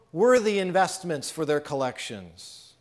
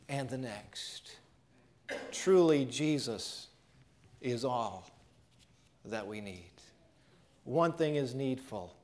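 ordinary talk, nothing marked

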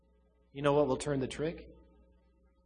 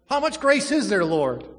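normal talking voice

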